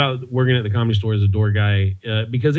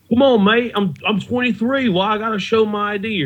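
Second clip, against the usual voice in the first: much higher, hammed-up Australian accent of a bar customer; bad Australian accent; 9 semitones higher than usual. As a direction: play a pushy Aussie punter in a deliberately bad Australian accent. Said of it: "That's a terrible Australian accent".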